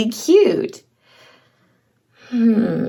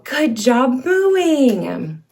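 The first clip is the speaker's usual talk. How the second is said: ordinary delivery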